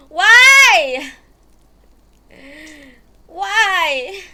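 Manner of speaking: loud, high, wailing why; despairing why. Real, not acted. Streamer forced to restart everything, loud despairing wail.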